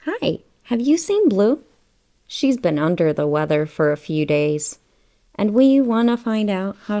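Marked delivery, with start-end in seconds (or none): none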